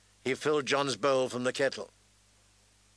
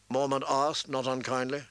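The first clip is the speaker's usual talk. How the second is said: ordinary delivery, nothing marked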